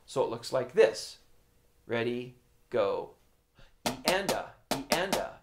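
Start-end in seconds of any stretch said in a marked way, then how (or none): 3.9-5.3 soft steady counting in time with the drums; quiet metronome-like count; counting aloud in rhythm. Shown as a demonstration. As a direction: chant the counts quietly and evenly. Counts rhythm while playing.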